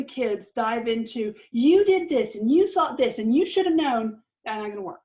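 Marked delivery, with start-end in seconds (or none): 1.5-4.2 louder, higher blaming list; accusing. Retold or imitated. Parent scolding a child with a list of blame. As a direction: hammer each charge like a fed-up parent.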